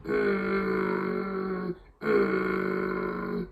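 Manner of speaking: Kermit the Frog growl. Shown as a demonstration. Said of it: "make a kind of Kermit the Frog sound".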